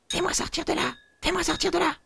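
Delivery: very high, small trapped creature; tiny spirit voice. Acted. Spirit in the bottle calling for help, very high and small. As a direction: squeak it out like something tiny and trapped.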